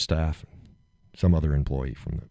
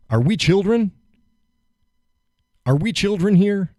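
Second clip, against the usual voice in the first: much higher, indignant disbelief; incredulous outrage; 13 semitones higher than usual. Real, not acted. Man venting outrage at being scolded for swearing. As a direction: throw it out in disbelief.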